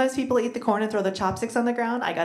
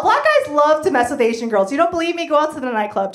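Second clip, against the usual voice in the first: ordinary talk, nothing marked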